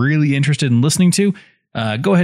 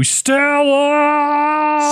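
high anguished yell of a name; Stella yell; 12 semitones higher than usual. Acted. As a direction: bellow it up to a window. Streetcar yell bit.

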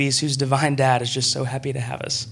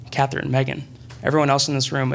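ordinary talk, nothing marked

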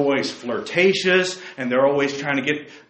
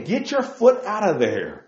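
normal talking voice

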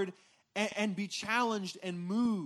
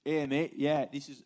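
low-pitched mock Australian; badly faked Australian accent, trailing away; attempted Australian accent; 6 semitones lower, 4 dB louder than usual. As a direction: have a clumsy go at Australian.

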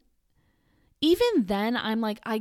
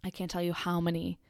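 ordinary delivery

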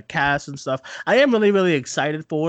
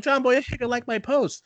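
laughing; 8 semitones higher than usual. Retold or imitated. Retelling the big news, laughing.

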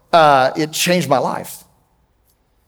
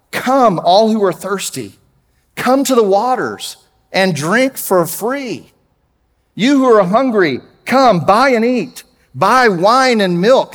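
raised, slow preaching read; scripture reading; 7 semitones higher, 4 dB louder, slower than usual. Acted. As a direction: proclaim the verses like an invitation from the pulpit. Preacher reads Isaiah aloud.

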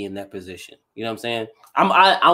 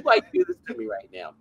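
mock whining; 9 semitones higher than usual. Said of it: "'Cause I'd be like".

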